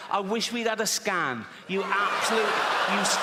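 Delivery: high, spluttering comic rant; mock outrage. Comic berates Timmy for prejudice, mock outrage. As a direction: rage at the boy, running out of insults. Mock tirade at the imagined boy.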